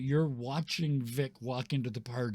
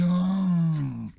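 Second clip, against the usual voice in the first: loud, high, dopey yeti "Oh"; a big sad yeti lowing "Oh"; yeti groan; 7 semitones higher, 11 dB louder than usual. Acted. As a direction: bellow it like a sad, dim yeti. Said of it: "He goes".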